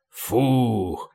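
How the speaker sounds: long low "phew"; big puff of relief; relieved exhale. A narrator's reading. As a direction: let out a held breath in relief. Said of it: "выдохнул Полубой".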